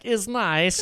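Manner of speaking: high mock Borat voice; Borat accent. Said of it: "the Borat thing".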